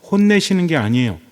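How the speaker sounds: ordinary delivery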